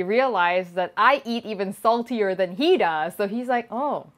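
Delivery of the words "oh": ordinary delivery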